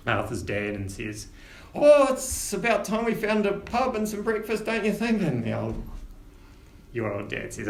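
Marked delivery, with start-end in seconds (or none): 1.7-5.2 lightly voiced, higher cheerful gnome woman; a hint of a chirpy old lady; cheery gnome mum. Gnome mother to Arthur's dad.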